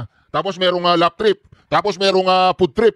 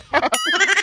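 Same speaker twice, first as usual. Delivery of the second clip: mocking laugh; 8 semitones higher than usual. Real, not acted. Laughing at the crying president.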